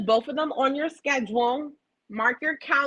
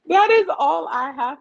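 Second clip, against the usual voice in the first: laughing; 7 semitones higher, 6 dB louder than usual. Real, not acted. Laughing sign-off to audience.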